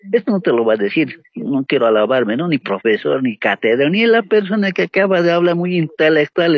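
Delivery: normal talking voice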